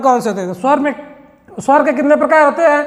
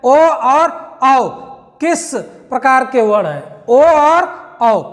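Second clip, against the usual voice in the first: slow reading; slower than usual. Shown as a demonstration. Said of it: "slow reading of question".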